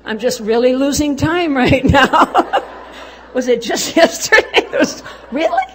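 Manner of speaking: high, loud, helpless laughter through the words; real cracking up mid-sentence; laughing. Real, not acted. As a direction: laugh at yourself as you talk. Laughing at her own lost time.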